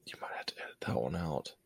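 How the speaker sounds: hushed, quick aside under the breath; whispered aside. Real, not acted. Aside to co-host after losing thought.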